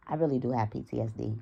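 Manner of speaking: normal talking voice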